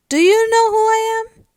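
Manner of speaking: very high, loud, alarmed question; concerned. Acted. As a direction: blurt it out as a worried schoolboy, voice shooting up. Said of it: "Looking concerned".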